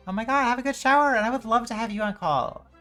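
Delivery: high, loud burst of enthusiasm; excited gushing. Real, not acted. Streamer wishing a friend a good shower, excited gushing. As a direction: gush loudly to a friend.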